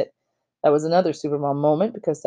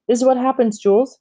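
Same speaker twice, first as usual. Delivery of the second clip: high, sharp outburst; blaming her husband; angry mother; 7 semitones higher, 4 dB louder than usual. A narrator's reading. Mother scolding dad, furious. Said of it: "madder than I had ever seen her".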